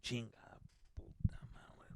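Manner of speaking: whispered curse under the breath; mad dad muttering. Retold or imitated. Dad all mad before faking it, whispered cursing. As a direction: swear in a private, irritated whisper. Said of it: "right now he was all mad".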